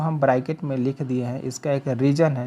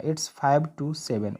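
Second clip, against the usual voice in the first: each word drawn out for learners; very slow model sentence; 3 dB quieter, much slower than usual. Shown as a demonstration. Teacher showing students how to say the time, very slow. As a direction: dictate it word by word, very slowly. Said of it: "सिर्फ क्या बोलना है".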